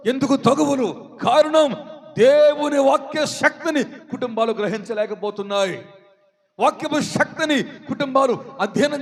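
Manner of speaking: forceful, driving sermon delivery; urgent pulpit exhortation; full-force preaching. Real, not acted. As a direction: preach it out to the congregation.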